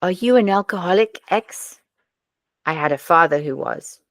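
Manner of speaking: put-on amateur Scandi accent, questions lifting at the end; attempted Scandinavian accent. Podcaster quoting a Scandi crime dialogue, attempted Scandinavian accent. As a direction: have a rough go at a Scandinavian accent. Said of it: "I'll have a go at the accent".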